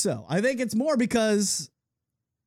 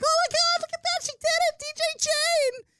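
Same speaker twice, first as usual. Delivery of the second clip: put-on much higher girly squeal, dragging out the name; mock squealing friends. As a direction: squeal like her giddy friends. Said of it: "Her friends are like".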